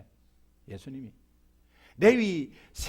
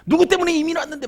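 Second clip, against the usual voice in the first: complaining father; 7 semitones higher, faster than usual. Retold or imitated. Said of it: "막 이렇게 하잖아요".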